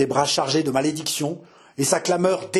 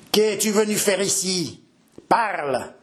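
higher, angry challenge to the intruder; growled demand; 6 semitones higher than usual. Acted. Old captain growling at Henri to speak. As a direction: bark the question at him. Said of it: "gronda le vieux capitaine".

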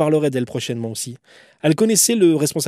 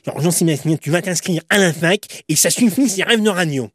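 put-on voice of a teacher telling off a pupil; imitated scolding teacher; 3 semitones higher, 4 dB louder than usual. Teacher telling a pupil to drop his radio dream, mock scolding. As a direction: tell him off in a caricatured teacher's voice. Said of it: "elle parlait un peu comme ça".

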